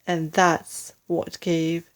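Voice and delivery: usual speaking voice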